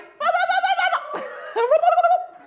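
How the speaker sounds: high, loud cackling laugh; burst of laughter. Real, not acted. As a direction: crack up at your own turkey. Asks for turkey noise, bursts laughing.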